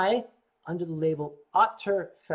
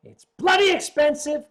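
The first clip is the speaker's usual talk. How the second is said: loud exclamation; 9 semitones higher, 9 dB louder than usual. Real, not acted. Outburst about price.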